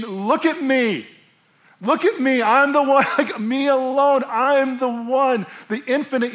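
high, loud, urgent proclamation; voice of Jesus. Acted. Preacher voicing Jesus to the crowd, loud and urgent. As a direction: call them to look at him alone. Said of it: "Jesus is saying".

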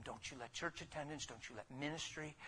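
ordinary delivery

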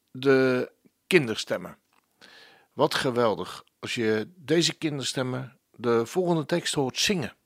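ordinary talk, nothing marked

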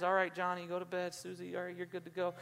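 soft, low-key tuck-in voice; hushed bedtime parent. Acted. Parent saying goodnight to kids at bedtime, hushed. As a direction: settle the kids down quietly.

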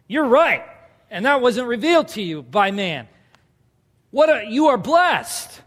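a proud teacher beaming; Jesus cheering Peter on; delighted praise. Acted.

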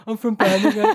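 high, mock dopey voice, laughing; mocking herself. Mocking how she sounded on the radio, high and dopey. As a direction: parody your own daft answer. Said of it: "I looked like the worst person".

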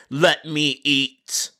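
very slow, drawn-out demand; dragged out plea. Acted. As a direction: stretch every word like a whine. Customer pleading.

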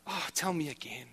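lightly voiced wife asking to hear it again; wife begging softly to hear it again; soft wife's plea. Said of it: "she says".

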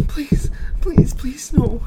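high breathless whispered begging; terrified whisper. A narrator's reading. Child whispering in terror to his father. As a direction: barely voice the plea.

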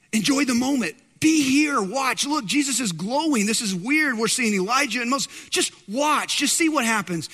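a friend begging him to just look and be amazed; lightly voiced excited disciple; awed excitement. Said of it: "you gotta know that John's going".

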